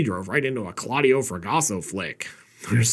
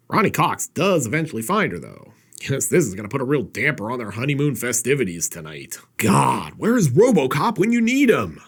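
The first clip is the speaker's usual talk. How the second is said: normal talking voice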